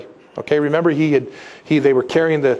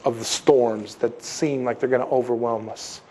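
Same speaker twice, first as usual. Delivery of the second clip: normal talking voice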